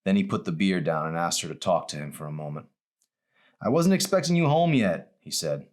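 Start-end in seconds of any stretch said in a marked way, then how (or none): 3.6-5.2 a flustered father caught drinking; startled father. Acted. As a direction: caught off guard, higher and louder.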